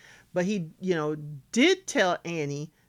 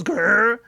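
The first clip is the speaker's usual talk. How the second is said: long, louder playful "grr"; mock growl; 7 dB louder than usual. Recapper teasing K B about her claws, mock growl.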